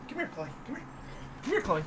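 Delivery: high, soft coaxing; gentle high call to a pet; coaxing pet talk. Real, not acted. Owner coaxes dog over.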